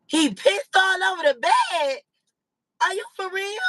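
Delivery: high, loud and appalled; shocked disbelief. Retold or imitated. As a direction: ask it in stunned disbelief. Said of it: "The lady at the front desk told him".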